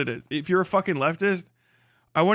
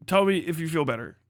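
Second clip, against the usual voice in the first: ordinary talk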